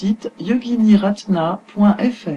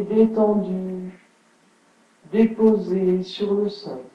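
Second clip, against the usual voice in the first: unhurried yoga nidra guidance with long pauses; guided relaxation; 2 semitones lower, 4 dB quieter than usual. Acted. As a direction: settle listeners into stillness, phrase by phrase. (voix posée de relaxation guidée)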